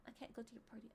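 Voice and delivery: soft whispered reading; whispering to oneself. Real, not acted. Streamer mulling over quiz options, whispering. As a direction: read it under your breath. Murmurs answer option while thinking.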